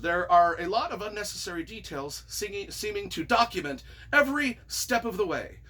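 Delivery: ordinary talk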